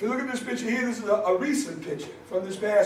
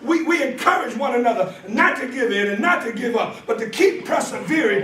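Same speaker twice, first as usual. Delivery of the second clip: raised preaching voice, driving each phrase; 4 semitones higher, 3 dB louder than usual. Acted. Preacher rallying the congregation about school brothers, preaching.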